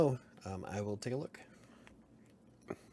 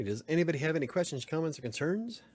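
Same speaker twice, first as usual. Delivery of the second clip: higher, quicker, bright question to chat; upbeat call-out; 6 semitones higher, 3 dB louder than usual. Real, not acted. Streamer inviting chat's last questions, upbeat and quick. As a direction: toss it out to the whole chat, eager.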